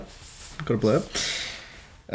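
unvoiced, slow whisper of It's got a blurb; whispered aside. Real, not acted. Muttering to himself while finding the blurb, whispered. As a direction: breathe it out as a quiet aside.

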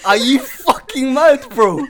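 high, loud, slow indignation; hammed-up incredulous yell; mock outrage. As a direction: act out outraged disbelief, drawing words out. Retelling own outraged reply to friend.

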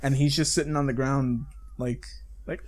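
ordinary talk

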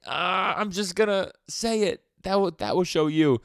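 high, loud, worked-up outburst; winding up to spill a secret, high and loud; a boy losing patience with a critic; Denji, blurting. Retold or imitated. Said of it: "Denji's like".

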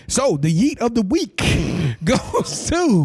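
high-pitched award announcer build-up. Acted. Host presenting the yeet of the week to listeners, hyped announcer voice. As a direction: announce it big, pitched well up.

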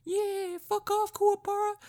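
very high, squeaky cartoon voice; hammed-up squeaky little brat; Rinku. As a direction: shoot up into a tiny falsetto taunt. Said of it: "pops out and he's like".

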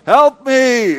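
high, loud help me; mock shout for help. Preacher imagining shouting in the mall, loud. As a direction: yell it in the mall as a joke. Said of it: "tempted sometimes to stand".

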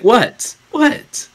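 high-pitched imitated protest; squeaky-high acted puzzlement; mock puzzled query. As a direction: play an innocent kid questioning, voice jumping up. Acting out Dustin's puzzled reply.

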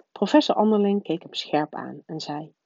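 usual speaking voice

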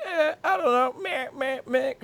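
high, dismissive mumbling "meh"; mock himself sounding clueless and bored; mocking blah blah. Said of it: "that was my imitation of me".

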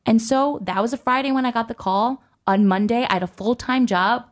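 usual speaking voice

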